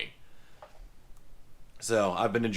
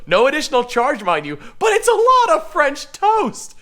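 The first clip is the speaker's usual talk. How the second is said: indignant protest; 12 semitones higher, 8 dB louder than usual. Real, not acted. Defending himself against teasing.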